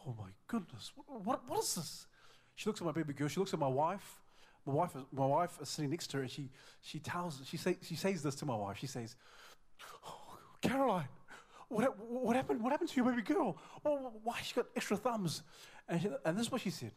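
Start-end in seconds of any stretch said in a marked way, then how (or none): 0.1-2.1 gasp, then high horrified questioning; shocked aunt. Retold or imitated. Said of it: "she was in shock". 10.0-15.4 high-pitched, dismayed auntie imitation; shocked aunt. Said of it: "she was in shock".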